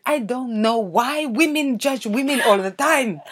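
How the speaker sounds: slow indignant mum. Retold or imitated. Mum voiced in story.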